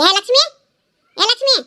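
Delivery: rising call to fetch someone. Acted. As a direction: call for the daughter, rising at the end. Calling daughter from another room.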